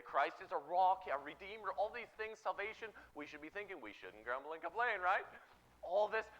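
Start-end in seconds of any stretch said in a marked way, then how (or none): none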